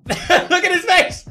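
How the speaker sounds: high, loud, laughing cry; loud, drawn-out amused hoot; laughing exclamation. Real, not acted. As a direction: crow it through laughter. Laughing reaction to clip.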